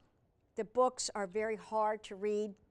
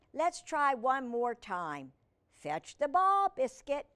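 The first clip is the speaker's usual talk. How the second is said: raised, loud, drawn-out call; encouraging command; 4 semitones higher, 5 dB louder than usual. Acted. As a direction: coax a puppy to try. Girl commands puppy once more.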